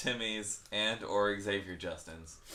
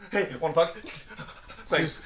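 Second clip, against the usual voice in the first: hammed-up speedster; 7 semitones higher, 4 dB louder than usual. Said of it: "acting the speedy boyfriend".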